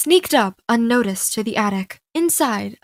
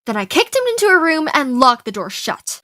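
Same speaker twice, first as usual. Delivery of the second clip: usual speaking voice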